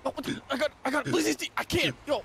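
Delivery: high shouting protest; disbelieving shout. Real, not acted. Losing the glizzy contest, high disbelieving shout. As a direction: yell it out in disbelief.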